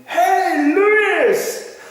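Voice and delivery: high, loud call across the parking lot; excited yelled greeting. Retold or imitated. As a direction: shout his name from far off with joy. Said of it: "screaming at him".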